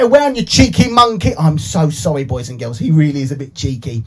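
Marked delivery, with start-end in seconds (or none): none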